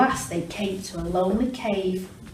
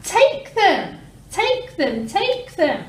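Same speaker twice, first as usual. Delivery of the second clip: high, loud echo fading; 11 semitones higher, 6 dB louder, slower than usual. Acted. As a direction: ring back tempting. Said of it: "came back the welcome sound".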